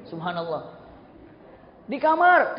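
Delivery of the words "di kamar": high, loud holler; shouting back. Acted. Parent answering child from the room, shouting back. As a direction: yell it from another room. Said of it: "teriak lagi".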